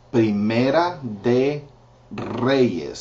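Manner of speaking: Reyes with a rolled Spanish r; teacher showing the rolled R; Spanish r sound. Shown as a demonstration. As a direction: roll the R in Reyes for the student. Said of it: "See the R?".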